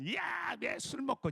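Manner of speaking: high-pitched mock nagging yelp; old-style scolding. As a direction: imitate a wife scolding her drinker husband. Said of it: "옛날에는". (높은 음으로 잔소리하는 흉내)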